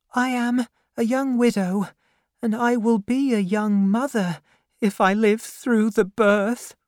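put-on high, pleading young woman's voice; a frightened girl near tears; tearful young widow. The widow explains herself to the aunt, high and pleading.

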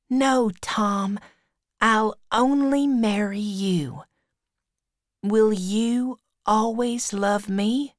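put-on high girlish character voice; high, earnest girl in love; Becky, devoted schoolgirl. A narrator's reading. Becky promising to marry only Tom, high earnest girl's voice.

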